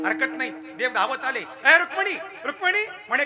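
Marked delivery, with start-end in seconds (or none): 1.4-2.9 high, loud hail to wife; excited raised summons; calling out. Acted. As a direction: call the name across the house. God runs calling his wife.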